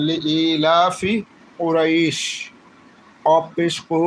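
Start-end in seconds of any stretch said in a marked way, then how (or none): 0.1-1.1 Quranic recitation, drawn out slowly. Shown as a demonstration. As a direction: recite it slow with the long vowel held. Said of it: "আল্লাহ বলছেন".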